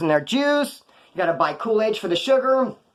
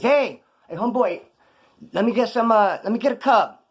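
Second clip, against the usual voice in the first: retold call down the line, pitched up; mildly raised voice, hailing a homeboy; 4 semitones higher than usual. Southsider asks down the line for a cup, calling out.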